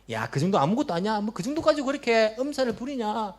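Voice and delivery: high, brushing off someone's pain; a harsh church elder belittling a sufferer; dismissive scoffing. Acted. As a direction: scoff at their complaint. Harsh believer mocking sufferer.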